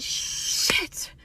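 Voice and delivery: long hissed 'sh' opening the word; stretched, breathy oh-no curse; dramatic realization. Acted. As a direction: draw out the first sound in dawning horror. Dramatic realization of mistake in story.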